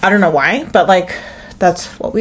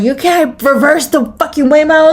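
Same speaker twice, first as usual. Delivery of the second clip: higher-pitched exasperated complaint; retold indignant protest, voice pitched up; mad protest; 7 semitones higher than usual. Rider arguing with Waymo staff, mad. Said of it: "I was so mad".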